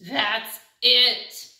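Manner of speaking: loud sudden outburst; fed-up pigeon. Acted. The pigeon losing patience with the duckling, loud. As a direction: snap that he's had enough.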